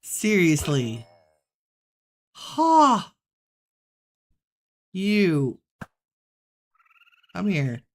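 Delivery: loud annoyed yelling at the mob; fighting yell. Real, not acted. Gamer fighting a rushing mob, loud yelling. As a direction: bark at the enemy mid-fight.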